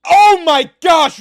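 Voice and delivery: loud, stunned shout. Real, not acted. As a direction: yell it out in shock. Goal chance reaction.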